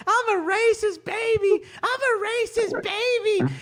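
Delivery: high, slow baby voice with mock crying. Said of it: "baby racist routine".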